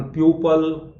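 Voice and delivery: प्यूपल with a 'pyoo' glide; drawn-out wrong प्यूपल; students' mispronunciation. Retold or imitated. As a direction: imitate learners saying 'pyoo-pal'. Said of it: "बच्चे लोग इसका उच्चारण".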